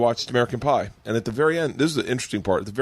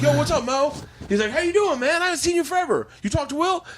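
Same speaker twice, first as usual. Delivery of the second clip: much higher, eager catching-up; excited greeting. Retold or imitated. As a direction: greet an old friend with delight.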